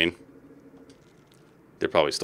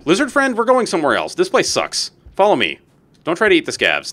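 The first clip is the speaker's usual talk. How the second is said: bossy leader; 8 semitones higher, 3 dB louder than usual. Pretend. Commanding creature companion.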